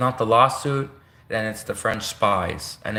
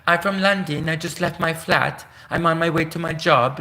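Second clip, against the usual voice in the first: higher, sweet and innocent London voice; lightly put-on English accent, raised pitch; sweet British accent; 4 semitones higher than usual. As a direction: play a harmless-sounding Londoner. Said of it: "they always sound so sweet and innocent".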